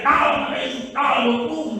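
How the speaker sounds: high, insistent command; re-enacted exorcist cry. Preacher retelling how he kept commanding the demon, high insistent cry. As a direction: invoke the name urgently, pitched high. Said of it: "Terus saya teriak".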